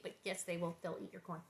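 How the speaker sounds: ordinary delivery, nothing marked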